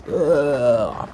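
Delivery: high, loud disgusted groan; grossed-out yelp; disgusted ugh. Real, not acted. Disgust at eating bodies.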